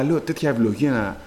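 normal talking voice

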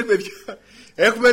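calling out. Acted. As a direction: call over to them, loud and high. Christ calls out to the fishermen.

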